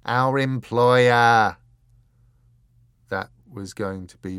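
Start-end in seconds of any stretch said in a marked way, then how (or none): 0.0-1.5 very loud, drawn-out words; enraged. A narrator's reading. Croup correcting his partner's guess, loud and enraged. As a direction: boom it slowly in exasperated rage.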